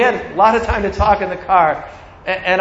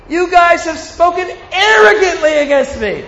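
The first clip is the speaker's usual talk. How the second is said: much higher, half-laughing accusation; God accusing; 8 semitones higher than usual. Acted. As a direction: call them out with a laughing edge.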